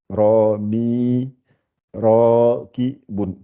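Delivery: "ra, mi, ra, ki, bun" sounded out slowly, long vowels; lengthened syllables. Shown as a demonstration. Teacher showing the lengthening letter, "ra, ki, bun" drawn out in syllables. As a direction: hold "ra" and "mi", pausing between syllables. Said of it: "c'est pour allonger le i".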